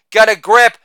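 ordinary delivery

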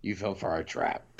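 low evil gloating laugh; villain laugh. Retold or imitated. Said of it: "he was gonna change and be like".

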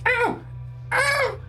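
high pained goblin yelps; goblin yelping in pain. Acted. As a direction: squeal like a hurt goblin.

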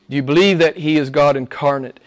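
ordinary talk, nothing marked

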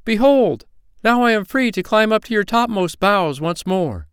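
loud, high, jubilant proclamation; joyful cry. A narrator's reading. Freed little man proclaims he can climb again, joyful cry. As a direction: exult at full voice. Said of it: "he cried in joy".

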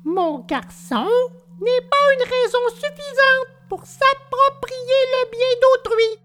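very high tiny insect voice; moralising firefly. Acted. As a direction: lecture primly in a squeaky little voice. Firefly lectures the puppet.

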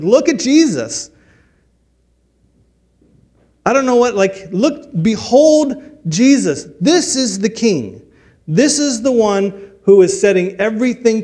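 preacher urging the congregation, high and loud; preaching at full force. Acted. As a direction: lift the room toward the king. Preacher urges listeners to behold Jesus.

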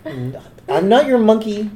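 laughing protest. Real, not acted. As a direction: laugh through it.

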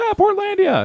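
very high imitation of excited fans; mock screaming. Podcaster imitating people hearing the theme come on, mock screaming. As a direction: squeal it like a crowd cheering a hit. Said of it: "people start screaming".

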